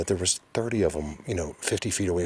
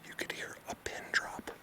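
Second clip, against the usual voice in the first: much softer voiceless whisper; 12 dB quieter than usual. Real, not acted. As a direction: hush it so nothing stirs. Hushed in the silent forest.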